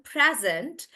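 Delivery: "present" slow, first syllable stressed; stress on PRE. Shown as a demonstration. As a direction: lean on PRE in "present", the noun. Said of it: "the stress is on pre".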